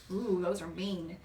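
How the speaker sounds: ordinary delivery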